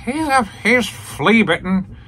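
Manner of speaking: high, slow, posh-sounding mock British old man; imitating Alfred. Narrator mocks Alfred sneering at the Moondog, high and slow. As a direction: put on the stuffy butler voice. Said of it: "And Alfred".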